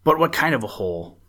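halting start, then a quick puzzled question; baffled, stuttering question; stammering. A narrator's reading. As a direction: stammer in confusion, then blurt it out. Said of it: "stammered Peter".

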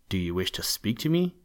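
ordinary delivery

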